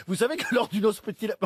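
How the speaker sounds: ordinary talk, nothing marked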